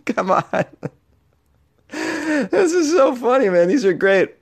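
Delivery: high-pitched, laughing through the words; real amused laughter in the voice. Real, not acted. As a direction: crack up while reacting. Cracking up at the letters.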